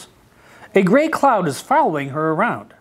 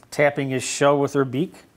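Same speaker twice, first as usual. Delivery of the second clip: usual speaking voice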